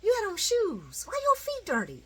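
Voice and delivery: high, incredulous teasing voice; mock incredulous. Fan mocking an actor's dirty feet, high teasing. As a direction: poke fun at the dirty feet.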